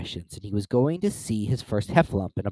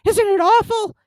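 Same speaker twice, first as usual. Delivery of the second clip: high, loud horrified squeak; tiny frightened creature, pitched way up; Piglet; 7 dB louder than usual. A narrator's reading. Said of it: "said Piglet".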